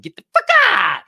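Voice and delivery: high, loud exaggerated outburst; mock yell. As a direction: yell it way up high as a hammed-up warning. Blurts Schiff's warning as a yell.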